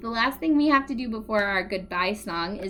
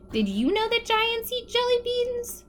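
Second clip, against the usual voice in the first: plain reading voice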